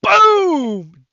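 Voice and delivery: loud, high punch sound effect; shouted boom. Retold or imitated. GM voicing Godfrey's punch, loud boom. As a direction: belt out the impact. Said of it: "narrated punch sound".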